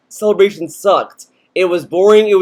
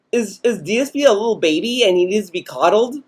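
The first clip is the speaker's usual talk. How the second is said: usual speaking voice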